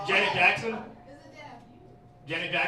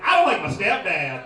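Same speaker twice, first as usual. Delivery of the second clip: self-mocking; 8 dB louder than usual. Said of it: "And I was like".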